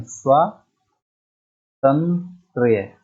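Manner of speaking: स्वातंत्र्य very slowly, split as swaa-tan-trya; drawn-out syllables. Shown as a demonstration. Teacher correcting students, स्वातंत्र्य syllable by syllable. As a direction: stretch स्वातंत्र्य out to the final -trya. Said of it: "स्वातंत्र्य होता है".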